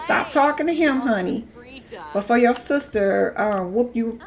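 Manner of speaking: ordinary talk, nothing marked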